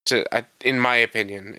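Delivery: normal talking voice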